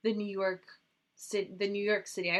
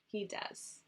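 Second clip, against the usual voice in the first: whisper; 10 dB quieter than usual. Real, not acted. Same words repeated, whispered.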